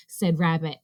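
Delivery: ordinary delivery, nothing marked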